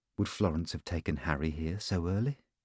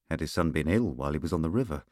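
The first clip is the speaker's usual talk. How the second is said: ordinary delivery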